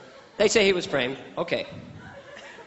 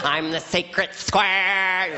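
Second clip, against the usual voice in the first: very slow, stretched-out caricature; hammed-up celebrity send-up; Paul Lynde impression; 3 semitones higher, much slower than usual. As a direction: drag every word in an exaggerated comic voice. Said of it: "like a spastic Paul Lynde".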